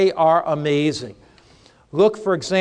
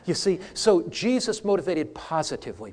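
normal talking voice

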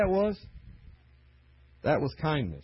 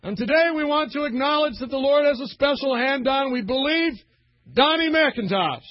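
pulpit announcement; 10 semitones higher, 6 dB louder than usual. Retold or imitated. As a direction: announce it to the whole tent. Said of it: "He goes".

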